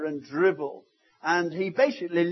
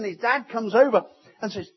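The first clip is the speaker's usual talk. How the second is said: usual speaking voice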